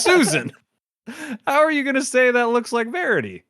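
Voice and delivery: high, loud, playful disbelief; mock incredulous. Player scolding Susan over the framed look-alike, mock incredulous. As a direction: protest in exaggerated, high-pitched bafflement.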